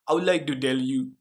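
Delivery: Indian accent, T turned to D. Shown as a demonstration. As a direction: imitate an Indian accent, "tell" said as "dell". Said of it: "Indian accent".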